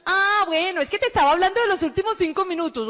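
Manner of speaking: high, singsong sales patter; gushing caller. Retold or imitated. Recruiter steers back to her pitch, high and gushing. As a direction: imitate a relentlessly perky recruiter. Caller gushes about five minutes.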